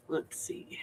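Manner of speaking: whispered, half to herself; whisper to self. Real, not acted. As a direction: mutter it under your breath. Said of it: "whispered to herself".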